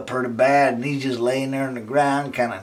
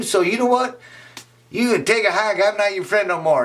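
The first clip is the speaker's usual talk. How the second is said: high, fast cowardly friend; Jerry backing out; 8 semitones higher than usual. Acted. Jerry dumps his beaten friend, high and fast. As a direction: rattle it off high and scared of trouble.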